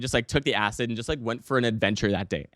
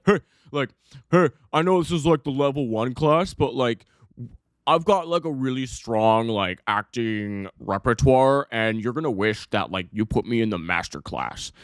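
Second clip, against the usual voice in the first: cocky show-off. Acted. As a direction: brag like the star of a beginner class. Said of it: "I'm ready to fucking flex".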